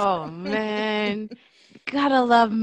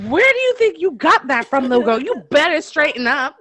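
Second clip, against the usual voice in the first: stern mom warning; 5 semitones higher, 4 dB louder than usual. Retold or imitated. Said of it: "I was like".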